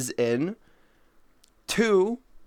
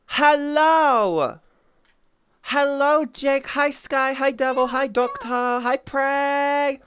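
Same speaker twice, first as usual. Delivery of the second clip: high sing-song hellos to each name; cheery greetings; 9 semitones higher, 4 dB louder, slower than usual. Real, not acted. Streamer greeting each viewer in chat, high and bouncy.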